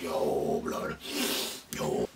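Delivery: low, soft mumbled yo; quiet low guilty reply; hushed. Retold or imitated. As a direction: mutter it low and small.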